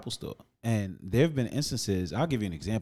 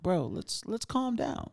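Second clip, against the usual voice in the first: soothing; 11 semitones higher, slower than usual. Retold or imitated. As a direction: talk him down gently. Narrator calming customer, high soft.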